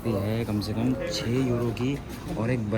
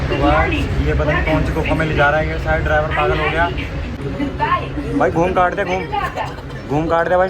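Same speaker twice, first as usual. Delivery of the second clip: loud, higher-pitched mock panic; joking alarm; 5 semitones higher, 8 dB louder than usual. Real, not acted. Rider telling the driver to turn, loud mock alarm. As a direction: call out in exaggerated alarm at the driver.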